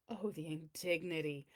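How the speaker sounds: hushed mock despair; whispering. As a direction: whisper it, crushed. Mock sorrow at second place.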